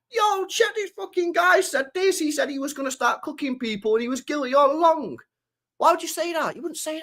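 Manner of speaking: outraged YouTuber impression. Streamer mimicking YouTubers reacting to his pulled clip, mock-scandalised. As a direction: imitate a YouTuber crowing over a clip. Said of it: "other YouTubers will pull it up".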